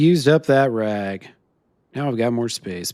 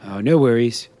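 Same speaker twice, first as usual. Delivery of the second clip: normal talking voice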